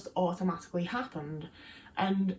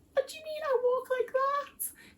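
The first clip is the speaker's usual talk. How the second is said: much higher, slow, incredulous squeak; indignant disbelief; slower than usual. Retold or imitated. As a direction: recoil at the remark as if offended. Retorting to uncle's remark.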